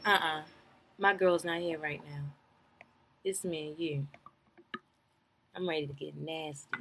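soft, low seductive imitation; mocking sultry low voice. Reality recap mimicking a woman's seductive soft voice. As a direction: purr it out like a temptress, mocking. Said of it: "And she talking like that?".

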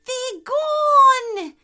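high, loud cry of relief; relieved potoroo. A narrator's reading. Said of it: "said the relieved Potaroo".

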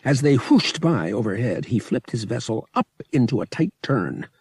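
ordinary delivery

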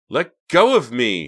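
high, loud, drawn-out yell; screamed. A narrator's reading. Reva fights off Hank's grab, screaming. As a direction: yell it out, stretching the words. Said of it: "she screamed at the top of her lungs".

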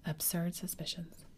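plain reading voice

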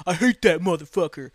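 loud mock outburst of a hockey dad; angry dad. Girl's dad giving Marchand the finger, loud imitated anger. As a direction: blurt it loudly as the heckling dad. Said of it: "the girl's dad just".

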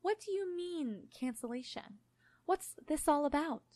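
slow, high, faintly patronising questioning; gently condescending. A narrator's reading. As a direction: coax slowly, as if to a child. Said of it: "gently and only slightly condescendingly".

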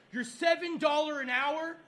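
high-pitched, indignant tirade; furious rant. Real, not acted. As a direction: rant in real outrage about the threat. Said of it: "I am fucking furious".

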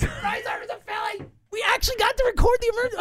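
high, giddy shout of joy; excited yell. Real, not acted. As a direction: yell the news at the top of your voice. Shouting the big news.

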